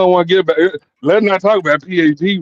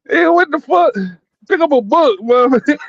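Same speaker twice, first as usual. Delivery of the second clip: mock exasperated yell; 9 semitones higher than usual. Imitating girlfriend's TV claim.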